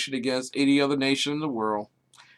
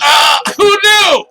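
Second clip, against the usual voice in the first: high, slow, loud exclamation; mock surprise; 12 semitones higher, 10 dB louder, slower than usual. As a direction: play it up, amazed.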